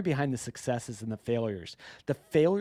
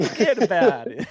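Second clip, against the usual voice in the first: loud, higher echo in mock New York accent; New Yorker accent; 5 semitones higher, 8 dB louder than usual. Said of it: "in your best New Yorker accent".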